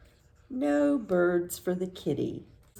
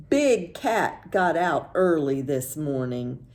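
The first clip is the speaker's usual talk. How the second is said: usual speaking voice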